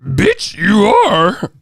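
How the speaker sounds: high, loud mock creature voice; Yoda. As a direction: squawk the chat message in a mock alien-sage voice. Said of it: "they talking like Yoda and shit".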